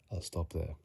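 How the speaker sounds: ordinary talk, nothing marked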